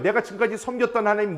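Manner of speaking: normal talking voice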